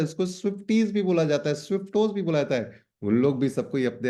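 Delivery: usual speaking voice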